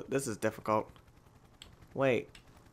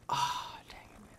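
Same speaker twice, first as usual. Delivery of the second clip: whispered frustration; 13 dB quieter than usual. Real, not acted.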